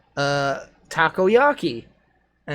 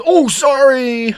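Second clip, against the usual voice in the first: startled apology; 10 semitones higher, 9 dB louder, much slower than usual. Retold or imitated. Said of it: "My initial act was".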